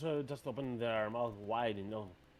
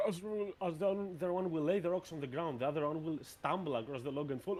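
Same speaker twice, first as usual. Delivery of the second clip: normal talking voice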